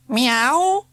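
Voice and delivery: high, loud meow; cat meow. A narrator's reading. Cat demands her letter from the postman, loud meow. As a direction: cry out like a cat. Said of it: "cried Zanzibar".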